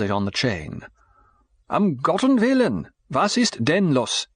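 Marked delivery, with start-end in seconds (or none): 1.7-4.3 woken man's irritated German, pitched higher; angry. A narrator's reading. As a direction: snap the question in German at the late caller. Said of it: "he inquired angrily".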